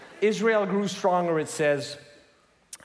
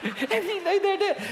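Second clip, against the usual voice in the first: higher, laughing through the line; 12 semitones higher than usual. Shown as a demonstration. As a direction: crack up reading it. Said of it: "the Bible is so funny".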